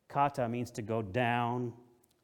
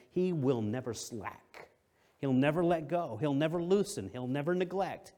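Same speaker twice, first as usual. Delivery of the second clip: building preacher's cadence, louder; preaching; 3 semitones higher, 4 dB louder than usual. Acted. Preacher to congregation, rising louder cadence. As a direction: hammer each line home to the congregation.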